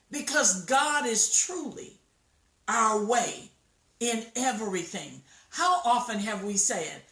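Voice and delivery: ordinary talk, nothing marked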